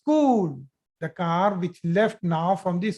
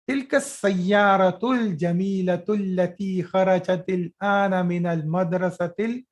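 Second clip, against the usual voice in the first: ordinary talk, nothing marked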